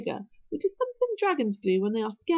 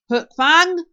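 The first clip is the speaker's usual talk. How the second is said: loud, higher-pitched reprimand; bellow; 6 semitones higher, 12 dB louder than usual. A narrator's reading. Stoic catching his hunting dragon misbehaving, bellowing. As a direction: bellow the name in reproof. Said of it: "bellowed Stoic".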